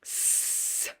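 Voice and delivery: s as a very soft airy hiss with no voice; soft voiceless s. Shown as a demonstration. Teacher to students, showing a voiceless s very softly. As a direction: barely breathe out s. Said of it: "I just don't engage my vocal cords here".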